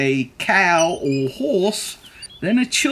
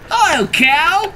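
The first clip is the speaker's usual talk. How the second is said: high, drawn-out greeting; Duck's cheery call; 12 semitones higher, 4 dB louder, slower than usual. A narrator's reading. Duck greeting Cow from the bike, high drawn-out call.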